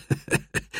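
soft, breathy little giggle; sly chuckle. A narrator's reading. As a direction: snicker over your secret. Ben giggles over his secret.

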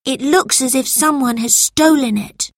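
put-on high-pitched child's voice; young boy. A narrator's reading. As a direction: voice a story-book boy, pitched well up.

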